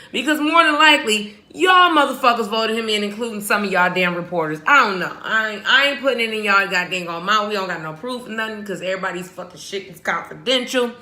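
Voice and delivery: ordinary talk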